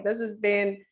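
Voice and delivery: ordinary talk, nothing marked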